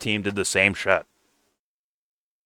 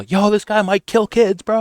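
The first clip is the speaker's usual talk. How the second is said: mock frantic warning, much higher and slower; 13 semitones higher than usual. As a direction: play the panicked messenger sounding the alarm, pitched way up. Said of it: "comes screaming to you".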